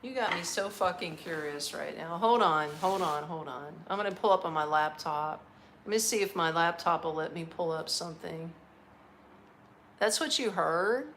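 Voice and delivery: ordinary talk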